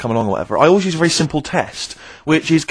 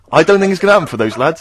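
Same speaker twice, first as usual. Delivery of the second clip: usual speaking voice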